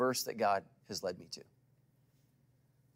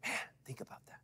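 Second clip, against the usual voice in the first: softer, breathy hushed whisper; 10 dB quieter, faster than usual. Acted. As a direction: lean in and whisper it to the room. Hushed aside to congregation.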